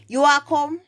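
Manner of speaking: high, loud Jamaican Patois question. Shown as a demonstration. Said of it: "you say".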